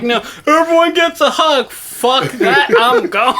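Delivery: high, loud, emphatic outburst; mock panicked refusal. Podcaster imagining fleeing the hugging bro, loud mock panic. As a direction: bail out loudly, hammed up.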